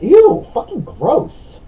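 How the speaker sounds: high, loud, drawn-out disgust. Real, not acted. Reader reacting to vomit on the hoodie, real disgust. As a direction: recoil with a long, revolted squeal.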